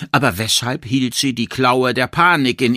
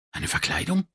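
hushed, higher-pitched question; leise Frage; 9 semitones higher, 8 dB quieter than usual. A narrator's reading. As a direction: breathe the question in sudden doubt. Stunned inner question in story. (leise, verblüfft gefragt)

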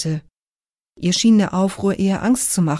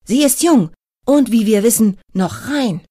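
higher, loud excited call from the crowd; excited heckling; 3 semitones higher, 3 dB louder than usual. A narrator's reading. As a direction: shout eagerly to be heard. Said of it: "Aufgeregt hüpfte der schlachsige Mann".

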